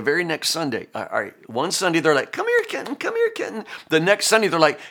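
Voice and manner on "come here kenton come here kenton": high, sing-song coaxing call; parents coaxing toddler. Retold or imitated. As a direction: coax a toddler to come to you. Parents calling toddler to walk.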